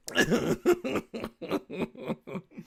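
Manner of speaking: high real laughter. Real, not acted. Laughing at the clip.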